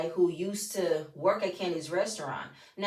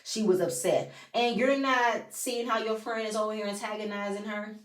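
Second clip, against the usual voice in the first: ordinary talk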